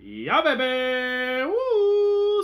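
loud, high whoop; loud celebratory whoop; excited cheer. Real, not acted. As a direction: cheer for the water. Celebrating the water.

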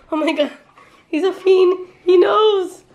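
giggling through the words; laughing high and loud. Real, not acted. Laughing at the pet.

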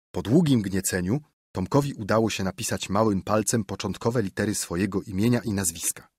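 ordinary delivery

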